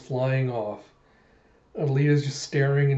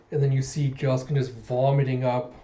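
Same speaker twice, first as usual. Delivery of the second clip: ordinary delivery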